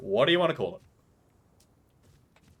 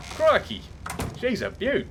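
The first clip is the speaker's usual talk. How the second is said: put-on butcher an Australian accent, higher; bad Australian accent; 8 semitones higher than usual. Streamer tries an Aussie accent on a tamed phoenix: hammed-up accent. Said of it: "I can't do an Australian accent".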